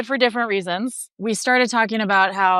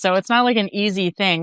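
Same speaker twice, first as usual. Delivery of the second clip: ordinary talk, nothing marked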